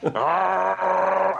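high, strained growl of a ghost box; imitated machine growl. Mocking the ghost hunters' machine with a growled Arrgh. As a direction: make the spooky machine noise. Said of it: "it's like".

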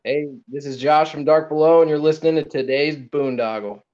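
loud, high, hyped show intro; promo ID. Shown as a demonstration. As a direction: pump it up like a radio ID. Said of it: "you mind cutting a promo I D for the show".

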